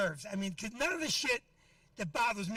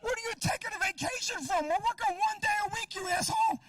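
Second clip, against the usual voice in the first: mock outrage, pitched way up; furious rant; 9 semitones higher than usual. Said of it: "I'm like".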